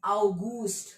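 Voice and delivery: अगस्त said the German way, "ow-GOOST"; German pronunciation. Shown as a demonstration. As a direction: open अगस्त on "au", then a long "oo". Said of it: "अगस्त को हम क्या बोलते".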